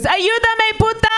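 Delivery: high, hammed-up scream for help; imitated desperate yell. Drowning son to his mother, high defiant yell.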